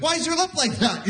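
put-on high-pitched child voice; little kid. Little kid asking about someone's lip, high child voice. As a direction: ask it like a tactless little kid. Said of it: "like, a little kid".

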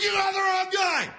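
high, yelled protest of innocence; hammed-up high shout; mock protest shout. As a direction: squeal it like a wrongly arrested man. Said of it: "It'd be like".

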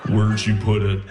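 low, creepy sleep-talker voice; Buffalo Bill. Acted. Wife asleep asks comic again, creepy. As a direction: ask it low, like a movie killer. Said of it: "Why do you sound like Buffalo Bill".

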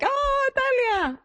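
high, slow, gushing "Oh, Talia!"; gushing greeting. Retold or imitated. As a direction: cry out her name with delight. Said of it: "I was like".

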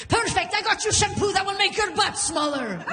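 high, hammed-up Mediterranean outburst; fiery dad. Dad firing back at mom over her gift, mock fiery retort. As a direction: explode in mock indignation. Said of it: "him with his Mediterranean personality".

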